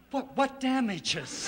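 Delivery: high, slow, stammered disbelief; incredulous. Acted. Insurance agent balking at a damages claim, incredulous. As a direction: balk at the very idea, voice jumping up.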